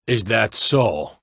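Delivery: ordinary delivery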